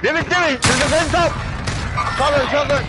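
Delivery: urgent callout. Real, not acted.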